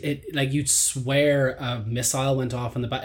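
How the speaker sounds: ordinary delivery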